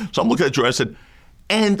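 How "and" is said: choked-up high squeak on one word; the voice cracks up high with held-back tears; voice breaking. Retold or imitated. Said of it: "Oh my God, I can't speak".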